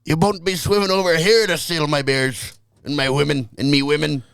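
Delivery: a brawling Scot warning off raiders, badly faked; failed Scottish accent. Friend trying a Scottish threat, badly faked accent. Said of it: "That wasn't it at all".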